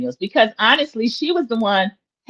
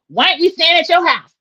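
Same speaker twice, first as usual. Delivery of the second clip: sudden high, louder retold blurt; 9 semitones higher, 4 dB louder than usual. Monique blurting to Angel, high and pushy. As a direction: blurt the question out of nowhere. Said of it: "Monique blurts out".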